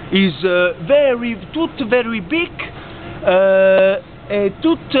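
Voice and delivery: put-on slow, halting English in a thick Italian accent; broken English, Italian accent. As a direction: fumble through English like a tourist hotel host. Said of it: "Un saluto inglese".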